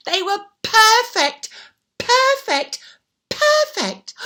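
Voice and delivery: rising delight. A narrator's reading. Storyteller building excitement as shoes fit. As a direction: build the joy step by step.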